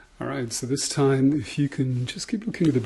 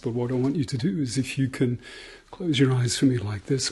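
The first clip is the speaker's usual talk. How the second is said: ordinary delivery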